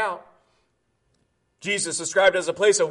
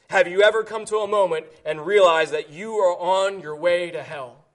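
ordinary talk

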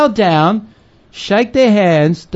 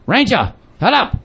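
raised-pitch hushed dog command; retold scolding; 4 semitones higher than usual. Missionary in his tent to his growling dog, retold scolding. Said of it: "and I go".

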